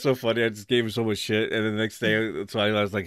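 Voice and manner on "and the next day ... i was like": ordinary talk, nothing marked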